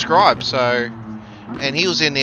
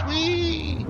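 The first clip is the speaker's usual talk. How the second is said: very high, soft mock squeal of glee; playful whee; 14 semitones higher, 5 dB quieter than usual. Joking about driving with no hands, a high soft mock squeal. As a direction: squeal like a kid on a ride.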